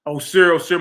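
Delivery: a loud, lifted call. Retold or imitated. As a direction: call him like a father summoning his son. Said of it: "Look what the creator's telling them".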